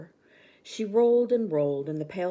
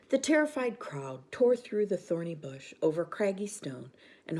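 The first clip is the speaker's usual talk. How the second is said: usual speaking voice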